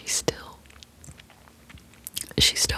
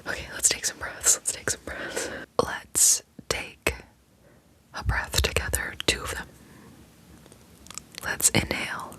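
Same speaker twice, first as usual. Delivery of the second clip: guided breathing. Acted. ASMR host calming herself and viewers, whispered guided breathing. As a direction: calmly guide each inhale and hold.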